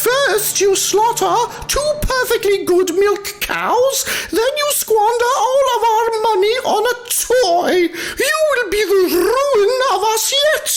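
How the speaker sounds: very high, fast ranting wife; a furious wife berating her husband; foul-tempered wife. A narrator's reading. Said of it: "exclaimed, foul temper".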